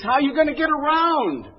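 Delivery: high, loud mock tirade; exasperated yelling. Psychiatrist to patient afraid to drive, exasperated yelling. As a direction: berate as a fed-up comic therapist.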